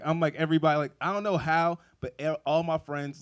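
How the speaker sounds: excited loud rant. Retold or imitated. Rattling off to the crowd about his drunk friends, loud and excited. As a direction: work the crowd up as the story builds.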